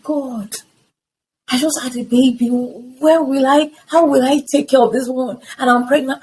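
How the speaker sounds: lightly replaying her grumble at God; grumbling at God. Woman retelling her grumble to God, mildly exasperated. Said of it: "I kept grumbling".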